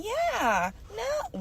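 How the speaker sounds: very high, sweet yeah, no; code-switched polite voice. Retold or imitated. Mocking polite talk around others, very high and sweet. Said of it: "be walking around someone".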